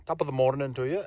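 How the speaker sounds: a playful Scottish-style holiday greeting; hint of a Scottish accent in a cheery hello; mock Scottish accent. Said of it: "I'm talking Scottish".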